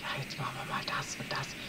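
soft, breathy, barely voiced muttering; quiet mumble. Shown as a demonstration. Streamer mocks his own timid quiet talk, whispered. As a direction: mimic timid, half-whispered planning. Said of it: "nicht immer so".

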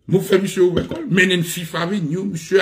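ordinary delivery

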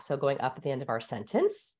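"sentence" rising like a question; uptalk. Shown as a demonstration. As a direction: lift the end, "sentence" sliding upward. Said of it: "There's up talk".

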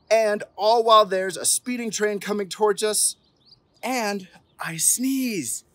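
storybook climax; climactic excitement. A narrator's reading. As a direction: land the big moment. Climax of the list.

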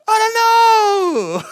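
high, slow, wailing I don't know; fake-weepy shrug; mock crying whine. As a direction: ham up a helpless sob. Repeat mock wail.

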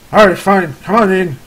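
exasperated farmer cutting visitors short; annoyed. Acted. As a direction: give in and let them inside, put out. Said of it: "he looks a little annoyed".

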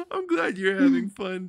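high, loud, laughing through fright; nervous laughing. Real, not acted. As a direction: laugh the words out. Laughing through fear at friend's enjoyment.

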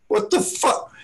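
very high, stunned squeak; disbelief. Retold or imitated. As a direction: react to a ridiculous budget. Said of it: "I was like".

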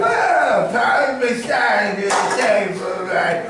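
high, loud, slow boozy Orson; drunk Orson Welles. Retold or imitated. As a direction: bellow the wine ad drunkenly. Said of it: "DiMaggio's up front".

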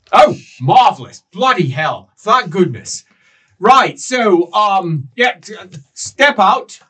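relieved welcome. Acted. Cultist gushing with relief as the party arrives, loud. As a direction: greet them like long-awaited rescuers.

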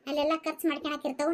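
usual speaking voice

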